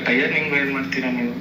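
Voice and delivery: ordinary delivery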